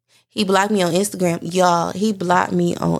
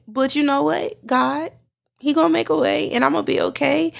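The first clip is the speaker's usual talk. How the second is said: higher, teary but firm; tearful resolve; 6 semitones higher than usual. Real, not acted. Reassuring herself and listeners after crying, tearful resolve. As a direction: push through the tears toward hope.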